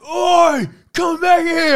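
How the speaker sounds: high, loud shout; yelling. Retold or imitated. Chasing the bloke down the street, shouting high and loud. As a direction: yell after him to come back. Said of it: "I'm starting to yell".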